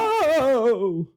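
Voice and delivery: long, high, loud mock "whoa"; startled pilot's "whoa". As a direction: draw out an alarmed whoa, imitating a rattled pilot. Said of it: "his response is".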